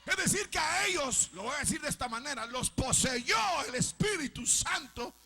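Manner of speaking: loud, high fervent preaching; impassioned shouted sermon; preaching at full force. Acted. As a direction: thunder it from the pulpit.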